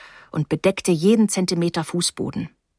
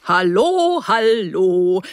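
calling out; 4 dB louder, much slower than usual. A narrator's reading. Dentist greets the arriving guest, calling out. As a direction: call the greeting out as you come in. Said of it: "rief er".

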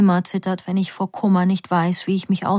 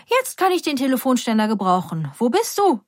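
mother; 5 semitones higher than usual. A narrator's reading. Mother dancing, looking for daughter.

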